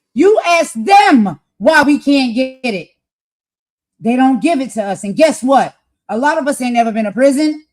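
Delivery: real impassioned loud rant; getting loud. Real, not acted. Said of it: "I'm getting loud".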